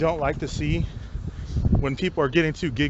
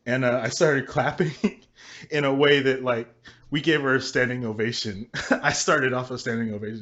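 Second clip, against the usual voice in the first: ordinary talk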